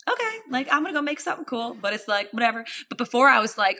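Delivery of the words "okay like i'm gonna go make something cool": much higher, light and easy; a carefree morning voice; breezy high quote. Retold or imitated. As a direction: toss it off breezily. Calm present self quoted.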